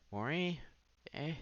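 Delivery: normal talking voice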